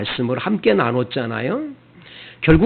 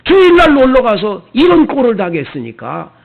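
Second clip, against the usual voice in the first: higher, louder mock scolding of the grieving family; retold scolding; 6 semitones higher, 7 dB louder than usual. Deacon rebuking the mourning parents, raised scolding voice. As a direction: scold them for going out on the Lord's day. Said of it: "막 이렇게 야단을 칩니다".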